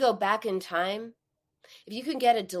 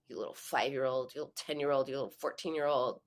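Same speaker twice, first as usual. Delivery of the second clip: mocking taunt; 6 semitones lower, 5 dB quieter, faster than usual. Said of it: "Would you say".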